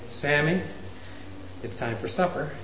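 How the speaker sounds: just say it once, quietly and calm; calm call. Shown as a demonstration. Parent calmly calling child to supper once. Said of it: "Why can't we say".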